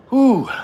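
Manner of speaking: sharp, high exhaled whew; loud whew, falling away fast. Real, not acted. Streamer balks at another drink, loud high whew.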